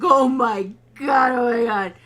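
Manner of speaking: loud, slow hammed-up cry; mock horrified wail. Host imitating Alice seeing the body, mock horrified wail. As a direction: stretch out the panicked wail, very loud. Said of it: "She's like".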